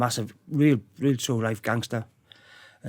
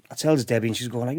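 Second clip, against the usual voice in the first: usual speaking voice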